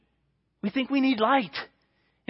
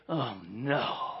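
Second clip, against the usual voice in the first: mock despair; 9 semitones lower, 8 dB quieter than usual. Quiet despair contrasted with thanks.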